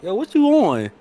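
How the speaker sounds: incredulous retort. Retold or imitated. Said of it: "you look at them like".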